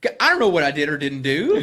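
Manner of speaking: laughing loudly through the words. Real, not acted. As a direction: blurt it out while laughing at himself. Laughing at his own fear.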